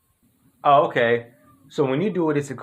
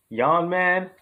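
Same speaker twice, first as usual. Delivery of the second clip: ordinary talk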